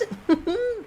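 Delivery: very high squeaky mocking cackle; high mocking merchant laugh; merchant's cackle. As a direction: cackle like a gleeful little huckster. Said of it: "the Doc Aebi's merchant-like voice".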